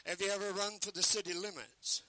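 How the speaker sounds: normal talking voice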